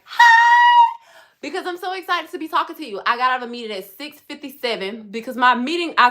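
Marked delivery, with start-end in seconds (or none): 0.1-0.3 very high, very loud hi; excited shout. Real, not acted. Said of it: "my energy level is like". 1.4-3.0 wired and buzzing; bubbly, fast-talking excitement; too much caffeine. Real, not acted. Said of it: "I'm wired from coffee".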